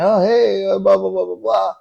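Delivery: high-pitched mock small talk; chatty. Passenger starts chatting to the cab driver, high and chatty. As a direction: imitate chirpy, empty chatter. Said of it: "I'm start to chat".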